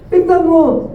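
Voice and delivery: high, sharp cry of the resentful son; the elder son yelling at his father, high and indignant; angry outburst. Acted. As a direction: throw the words back at the father in protest. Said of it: "Sabi niya".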